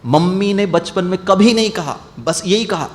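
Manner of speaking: ordinary delivery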